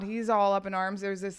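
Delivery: ordinary talk, nothing marked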